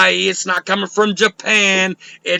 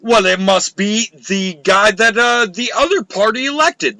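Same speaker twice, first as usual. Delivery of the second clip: ordinary talk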